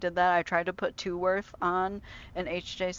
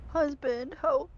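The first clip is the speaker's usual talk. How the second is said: teary plea; 8 semitones higher, slower than usual. Real, not acted. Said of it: "I'm actually crying tears".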